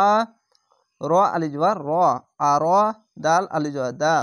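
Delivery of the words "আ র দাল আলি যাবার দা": ordinary talk, nothing marked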